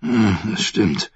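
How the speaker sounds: slow, grudging admission; reluctant. Acted. The prince grudgingly conceding to his niece. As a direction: give in unwillingly, slowly. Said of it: "Sein Tonfall klang widerwillig".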